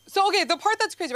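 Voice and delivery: high, loud, fast setup; high fast loud excited setup; excited build. Real, not acted. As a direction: rush into the best part. Building to punchline.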